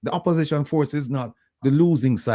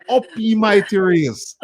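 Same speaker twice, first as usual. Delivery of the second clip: Garvey rallying cry; 11 semitones higher, 6 dB louder than usual. Retold or imitated. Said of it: "he would have said".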